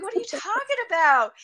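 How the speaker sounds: high, quick, incredulous question; retold bafflement. Asking friend what she means, baffled and incredulous. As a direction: fire it back high and fast in disbelief. Acting out her baffled reply.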